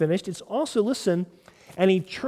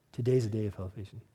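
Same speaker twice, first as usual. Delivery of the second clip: hushed answer; 9 semitones lower, 9 dB quieter than usual. Acted. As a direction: drop to a soft, low answer. Quiet answer to own question.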